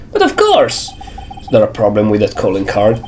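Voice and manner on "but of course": high, theatrical villain voice; squeaky showman exclamation; high character voice. Acted. Voicing Atme character.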